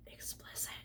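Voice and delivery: hushed conspiratorial whisper; whispered content warning. Acted. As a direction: whisper it like a naughty secret. Warning that the fic is explicit.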